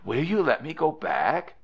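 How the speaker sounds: small, high-pitched pleading question; lightly acted girl's small voice. A narrator's reading. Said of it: "in a very small voice".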